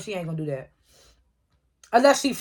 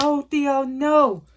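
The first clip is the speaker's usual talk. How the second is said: higher-pitched mock wail; mildly wailed, pitched-up protest; retold crying; 5 semitones higher, much slower than usual. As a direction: imitate an anguished cry, without real tears. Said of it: "Davis crying".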